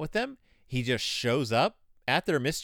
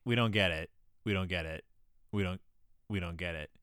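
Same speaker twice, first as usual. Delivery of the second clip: low, soft, mock-clueless murmur; repeated quiet, helpless mock complaint; dim disciples; 8 semitones lower, 6 dB quieter than usual. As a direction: say it small and low, imitating baffled followers. Retold disciples not understanding Jesus.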